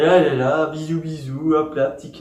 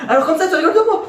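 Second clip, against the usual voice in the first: high, loud mocking mother voice; a mother half laughing, half threatening; mock mom threat; 11 semitones higher, 6 dB louder, faster than usual. Mère imitée, menaçante. (imitation de maman, aiguë et forte)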